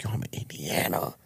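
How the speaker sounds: retold hushed reply. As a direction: imitate a friend letting out a secret. Retells friend's hushed answer.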